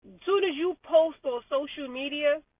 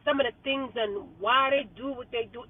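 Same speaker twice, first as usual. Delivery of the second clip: usual speaking voice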